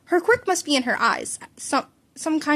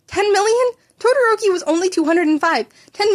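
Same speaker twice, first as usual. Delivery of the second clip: high, loud stunned question; shocked disbelief; 9 semitones higher, 6 dB louder than usual. A narrator's reading. Izuku staggered by the ten million points, shocked. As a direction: gasp it out in disbelief.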